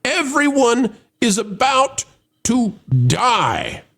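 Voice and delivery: high, drawn-out dramatic buildup; stretched, overblown movie-stakes delivery; melodramatic doom. Acted. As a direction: spell out the apocalypse with mock gravity. Dramatic summary of doom plot.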